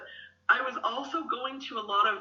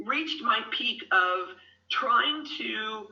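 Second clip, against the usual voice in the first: ordinary talk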